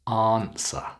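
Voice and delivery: "answer" said ahnser; long ah. Shown as a demonstration. Teacher models word for practice, long ah in "answer". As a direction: stretch the start of "answer" to ah. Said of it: "It's the long ah sound".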